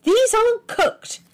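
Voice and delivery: high, loud, slow accusation; a slow, high, loud accusing charge; accusingly. A narrator's reading. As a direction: hold it up like evidence against him. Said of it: "she said accusingly".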